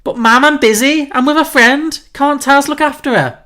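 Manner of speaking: high, loud Scouse daughter protesting; Scouse accent. Acted. Red argues with her mum on the phone, protesting in Scouse. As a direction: whine back at Mum in a high-pitched Liverpool accent. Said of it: "so the note is Scouse accent".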